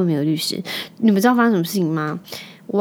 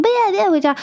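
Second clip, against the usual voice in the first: put-on higher, whining little boy refusing; whiny child; 12 semitones higher, faster than usual. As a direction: mimic the toddler's protest. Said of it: "但亨特说".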